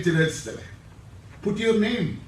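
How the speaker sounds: ordinary talk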